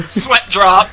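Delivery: loud, high gag callout; comic anime exclaim. Pretend. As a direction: call it like a cartoon effect.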